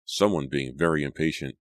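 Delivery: usual speaking voice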